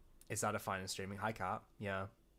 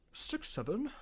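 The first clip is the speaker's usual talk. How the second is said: incredulous; 13 semitones higher than usual. Real, not acted. Reacts to drawing meme.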